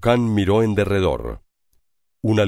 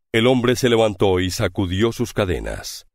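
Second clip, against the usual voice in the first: ordinary delivery, nothing marked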